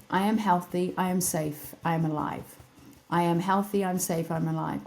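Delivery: soft, steady repeated affirmations; quiet affirmation. Shown as a demonstration. Teaching listeners to tap and affirm, soft and steady. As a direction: murmur each line as a calm promise to yourself. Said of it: "all you have to do is say".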